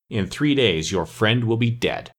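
ordinary delivery, nothing marked

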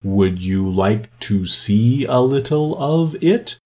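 slow, drawn-out turtle voice; Mock Turtle character. A narrator's reading. Mock Turtle offering Alice a dance demonstration, slow drawl. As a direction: stretch it out with a slow rise.

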